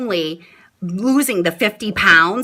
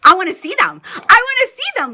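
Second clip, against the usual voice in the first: eager, higher and louder; excited; 10 semitones higher, 3 dB louder, faster than usual. Retold or imitated. Mom voicing her eager new self, excited. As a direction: say it with bubbly excitement. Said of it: "And now I'm like".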